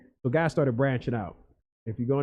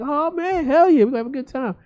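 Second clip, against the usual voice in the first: higher, fast, thrilled; retelling his own excitement, higher and fast; excited; 14 semitones higher, 4 dB louder than usual. Retold or imitated. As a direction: hype it up about the front seats. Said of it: "I was like".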